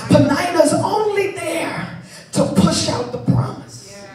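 normal talking voice

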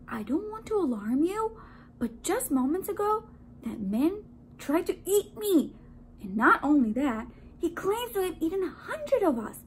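high, earnest donut voice; urgent warning. A narrator's reading. Donut warns baker of donut eater, urgent.

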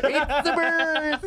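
high, slow mock American, near laughter; bad American accent. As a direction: echo the bad American audition, stretched out. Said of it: "as an American".

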